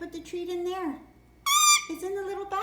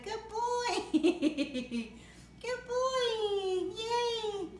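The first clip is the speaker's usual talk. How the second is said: happy laughing praise for the pet; 4 semitones higher, much slower than usual. Real, not acted. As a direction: cheer him on through giggles.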